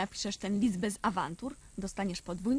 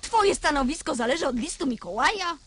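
indignant question; 4 semitones higher, 6 dB louder than usual. A narrator's reading. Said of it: "Zapytała mama".

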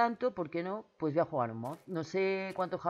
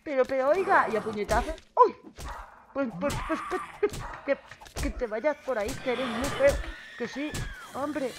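flustered; 6 semitones higher, 5 dB louder, faster than usual. Real, not acted. Fending off zombie.

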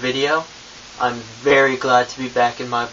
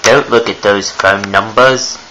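put-on British accent; 8 semitones higher than usual. Ghost narrating his trick to viewers in a British accent. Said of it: "talking in a British accent this whole time".

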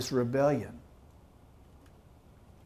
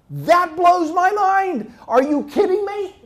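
amazed; 12 dB louder, slower than usual. Real, not acted. Preacher marvels at the mountain.

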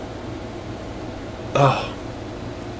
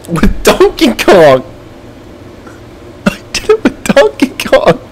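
triumphant; 10 semitones higher than usual. Real, not acted. Gamer proclaiming the win with Donkey Kong, triumphant. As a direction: savor the victory.

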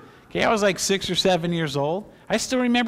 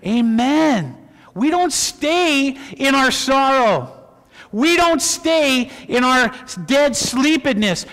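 high, loud, drawn-out proclamation; rally the congregation with ringing force; full-force sermon, stretching key phrases; exultant preaching; 6 semitones higher, 6 dB louder than usual. Real, not acted.

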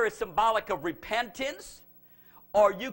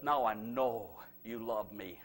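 much lower, hushed and tender; a hint of God's gentle approval; soft voice of God; 11 semitones lower, 4 dB quieter than usual. Preacher voicing God to Abraham at the altar, hushed.

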